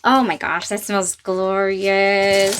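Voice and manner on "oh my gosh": ordinary delivery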